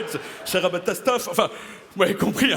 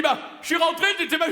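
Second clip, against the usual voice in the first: much higher, whiny excuse; voicing the drunk actor; 13 semitones higher, much faster than usual. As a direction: plead your excuse. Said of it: "Il me dit".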